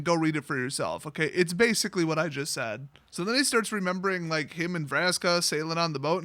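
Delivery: normal talking voice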